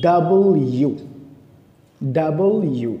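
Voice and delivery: British "ডাবল ইউ", two full words with the l sounded. Shown as a demonstration. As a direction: say "ডাবল ইউ" British style, double then you. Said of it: "ব্রিটিশরা বলে". (British উচ্চারণে স্পষ্ট ল দিয়ে "ডাবল ইউ")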